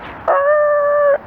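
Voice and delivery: a very high, strained screech for the brakes; squealing brake noise. Retold or imitated. Retelling the near crash, a very high mock brake screech. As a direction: squeal it out like tires locking up. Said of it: "we'll pretend that this is me braking".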